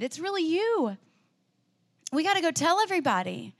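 laughing, astonished joy at seeing him alive; joyful recognition. Retold or imitated. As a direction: break from a hush into delighted wonder. Mary recognizes the risen Jesus.

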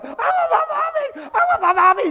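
put-on high, loud toddler tantrum; wailing child. Three-year-old being taken out of church, mimicked screaming tantrum. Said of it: "And the child started going".

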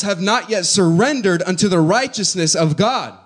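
rising, loud, fervent preaching. Acted. Pastor urging the church to surrender, preaching loud. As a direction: preach it out to the congregation.

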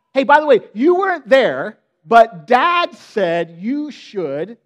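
loud, slow, sing-song tattletale claim; mock announcement. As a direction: belt it out like a kid telling on a sibling. Brothers boasting Dad's message.

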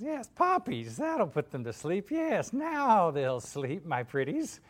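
high, slow, gloating witch; Wicked Witch. Acted. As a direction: draw it out like a plotting witch. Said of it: "She says".

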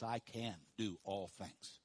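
soft and slow, I can do all things; quiet, slow affirmation. Shown as a demonstration. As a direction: say it gently and slowly, to yourself. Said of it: "Say that with me".

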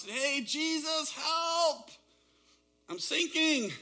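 high, loud cry for help; a hammed-up desperate yell while sinking. Peter sinking in the water, yelling to Jesus for help. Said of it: "he had time to cry out and yell out".

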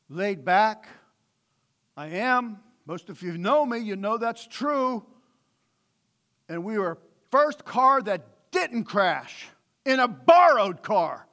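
usual speaking voice

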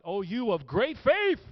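loud, high, drawn-out proclamation; mock grand praise. Preacher testing listeners with a false quote, grandly exclaimed. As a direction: proclaim it big, stretching the words. Deliberately wrong version of the quote.

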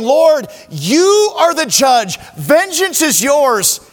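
a retold cry to God that rises as it goes; impassioned prayer. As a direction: pour it out, building in intensity.

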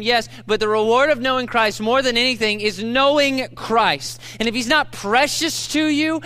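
rally the church, each phrase pushed; preaching at full force. Acted. Pastor urging the congregation to treasure Christ, forceful. Peak of sermon.

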